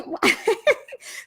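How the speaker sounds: high bright burst of laughter; delighted laugh. Real, not acted. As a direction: laugh with glee at a friend. Friend pops up in chat.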